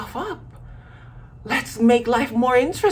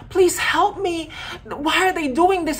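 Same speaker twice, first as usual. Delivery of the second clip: pleading; 6 semitones higher than usual. Retold or imitated. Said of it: "you run to your brother crying".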